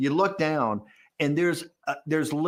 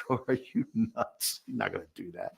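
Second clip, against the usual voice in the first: retold incredulous reply; 2 semitones lower, 6 dB quieter than usual. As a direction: scoff it quietly through a laugh. Retelling his own laughing, incredulous reply.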